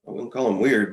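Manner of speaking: ordinary talk, nothing marked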